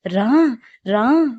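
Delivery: possessed woman yelling at her sister to come along; wild shouting. Retold or imitated. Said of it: "గట్టి గట్టిగా అరుచుకుంటూ".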